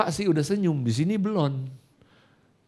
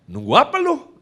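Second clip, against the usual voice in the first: high, loud mock challenge; snappish father; 13 semitones higher, 8 dB louder than usual. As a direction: snap it high like a pushy dad. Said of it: "Dia orangnya nyolot begitu".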